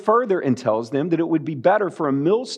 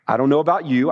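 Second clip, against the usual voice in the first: ordinary talk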